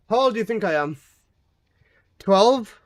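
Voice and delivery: ordinary talk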